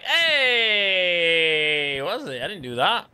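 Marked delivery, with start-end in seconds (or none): 0.0-2.0 one very long "heeey", sliding gradually down; long, falling exaggerated call; drawn-out "hey". Shown as a demonstration. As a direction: stretch out a mock "hey" for seconds. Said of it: "It wasn't like".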